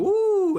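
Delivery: loud, high sustained "ooh"; mock-awed rising cry; imitated "ooh". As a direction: let out a big, high whoop of awe. Imitating reaction to arm cannon.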